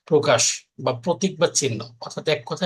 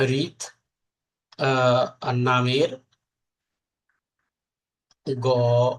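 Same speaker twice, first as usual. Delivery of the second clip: slow, word by word, with long pauses; slow dictation; 3 semitones lower, much slower than usual. Shown as a demonstration. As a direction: dictate each word slowly for students to write. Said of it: "লিখলাম রিত নামের গণক ধপস করে পড়ে গেল।". (ধীরে ধীরে শ্রুতলিখন)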